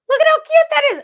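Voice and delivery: very high, loud gushing; excited high-pitched cry; delighted squeal. Real, not acted. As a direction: squeal over how cute it is. Delighted squeal at the stamped image.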